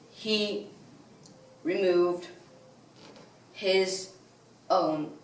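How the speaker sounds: word by word, long dramatic pauses; suspenseful halting. Acted. Villain reveals what he saw when no one was looking, halting. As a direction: hold back the terrible reveal, hushed. Suspenseful build to horrible revelation.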